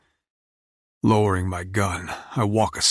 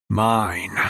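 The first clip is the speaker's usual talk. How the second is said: louder possessive. Acted. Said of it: "one word falling from my lips".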